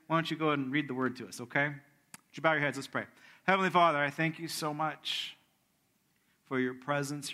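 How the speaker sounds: normal talking voice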